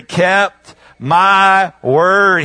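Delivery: very slow, drawn-out words; slow emphatic quote. Real, not acted. Preacher giving the congregation a scripture phrase, slow and emphatic. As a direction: stretch out each word deliberately.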